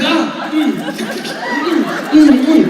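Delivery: high, greedy "mm" noises; pretend munching. Eating wings from the imaginary friend, hammed-up munching. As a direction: gobble with high-pitched mock delight. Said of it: "And I'm just like".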